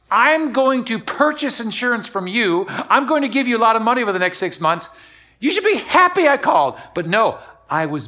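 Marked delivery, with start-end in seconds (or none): 0.1-6.8 indignant customer. Retold or imitated. Preacher replays his thoughts about a bad insurance call, indignant.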